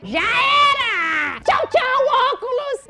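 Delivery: high, loud gleeful yell; triumphant shout. Real, not acted. Throwing the man's glasses away, high loud gleeful shout. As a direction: crow in triumph as the glasses fly.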